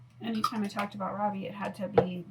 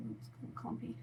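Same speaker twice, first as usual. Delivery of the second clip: whispered aside; 11 dB quieter, faster than usual. Real, not acted. Muttering while applying lipstick.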